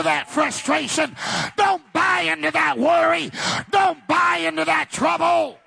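rally the whole church; preaching at full force. Acted. Preacher rousing the congregation against worry, shouting high.

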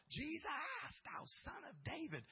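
high pleading cry, pitch jumping up; desperate high cry from the roadside. Acted. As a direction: call out to him over the crowd. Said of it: "Even louder, he cried out the more".

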